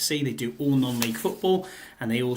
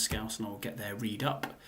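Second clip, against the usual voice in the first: usual speaking voice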